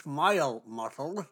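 talking with a pen clamped in teeth. Real, not acted. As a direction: speak around a pen held in the teeth. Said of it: "I'm holding a pen in my teeth".

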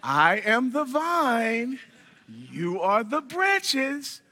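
very slow, stretched-out words with lifting ends; talking down slowly. Acted. Repeating the verse to someone slow, drawn out and patronizing. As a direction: say it as if the listener is slow. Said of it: "Why they talk to me like I'm slow?".

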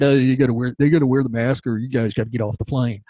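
low-pitched mock airline steward. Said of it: "I'm mocking their voice".

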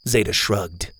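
plain reading voice

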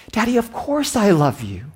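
put-on high child reply; sweet little girl. Daughter answering her father at bedtime. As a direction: answer brightly like a small daughter.

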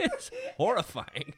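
high, giggling; laughing. Real, not acted. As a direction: say it through giggles. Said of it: "I got the giggles now".

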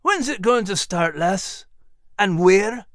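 Scottish lilt, asking eagerly; Scottish dog. Acted. Max the dog asks Clarice, light Scottish brogue.

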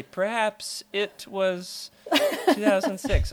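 slow, lightly voiced Thrawn impression, with a laugh; mock Thrawn. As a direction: drawl it slowly like a calm mock Thrawn. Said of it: "You could have just been like Thrawn and say".

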